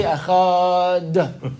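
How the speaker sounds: Echad stretched very slowly; drawn-out Echad. Retold or imitated. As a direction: hold out every sound of Echad. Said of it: "when you say Echad, you say Echad".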